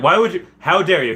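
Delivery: hammed-up how-dare-you; mock outrage. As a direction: scold them in jest.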